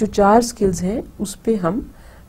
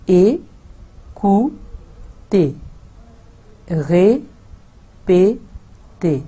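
ए, कू, ते, रे, पे, ते, each syllable paused; split into syllables; much slower than usual. Shown as a demonstration. Teacher sounding out both words syllable by syllable. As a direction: spell the words out in beats. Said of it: "दूसरे word में भी देखेंगे".

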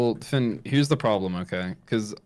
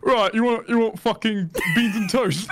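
higher mock British accent, laughing at the end; 10 semitones higher than usual. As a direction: play a cheeky Brit offering beans and toast in a British accent. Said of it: "British people like".

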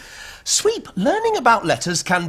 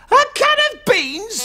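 incredulous shout; 10 semitones higher than usual. Real, not acted. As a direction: blurt it back in disbelief. Repeating a silly answer in disbelief.

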